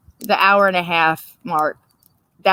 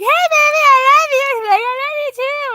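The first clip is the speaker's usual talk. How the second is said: very high, sing-song I love you; lovey-dovey couple; 5 dB louder than usual. Retold or imitated. Newly together couple cooing at each other, very high. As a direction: coo it way up high. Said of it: "I'm like".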